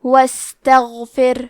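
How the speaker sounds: واستغفر slowly with a light thin ر at the end. Shown as a demonstration. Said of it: "حرف را کو پتلی آواز میں پڑھنا ہے".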